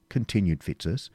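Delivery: ordinary delivery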